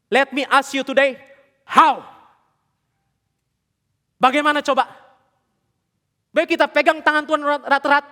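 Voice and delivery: loud, high, impassioned sermon; full-force preaching. Acted. Preacher urging the congregation about who holds whose hand, full-force preaching.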